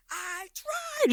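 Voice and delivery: soft, very high-pitched shriek; mock scream. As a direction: imitate screaming but hold the volume back. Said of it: "she's, like, screaming at the top of her lungs".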